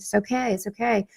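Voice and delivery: quiet, gentle it's okay, twice; soft reassurance. Retold or imitated. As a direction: soothe them softly, almost hushed. Reassuring a client at the end.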